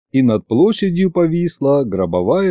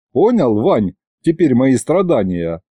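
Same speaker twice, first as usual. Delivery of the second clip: ordinary delivery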